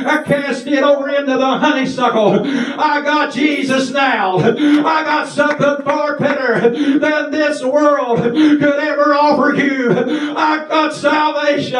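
fervent revival shout; preaching shout. Real, not acted. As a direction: preach it out to the church.

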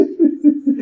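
high, short burst of laughter; joyful laugh. Real, not acted. Preacher rejoices that God never sleeps, real high laugh. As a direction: laugh out high in mid-sermon.

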